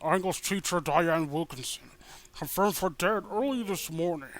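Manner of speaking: a lightly voiced solemn school principal; lightly put-on principal voice announcing a death; principal's speech. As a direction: address the assembly like a grave principal.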